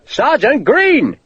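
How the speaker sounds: slow, drawn-out, high bellow. A narrator's reading. Sharp bellowing for the missing sergeant, slow bellow. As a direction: bellow the name across the hill. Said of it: "Sharp bellowed again".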